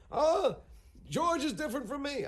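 high, loud whiny protest; frantic Larry. Retold or imitated. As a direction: whine it like a panicked man. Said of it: "frantic, going".

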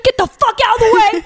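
high-pitched mock yell; pretend yelling. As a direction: clear a path like a bossy assistant, jokingly. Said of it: "yelling at people".

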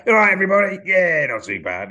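bright fake stage greeting; stage patter. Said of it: "So I'll be going".

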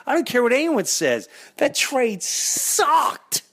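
high-pitched indignant fan rant; rant, pitch climbing with outrage; escalating comedy rant. Real, not acted. Fan rants about bad trade.

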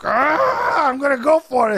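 high, strained battle-cry impression; imitating charged-up fighter. Commentator mimicking an impatient fighter for the coach, high mock war cry. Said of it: "he would get like that, that kinda like".

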